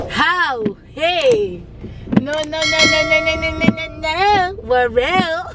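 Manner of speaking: excited disbelief. Retold or imitated. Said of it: "And I'm like".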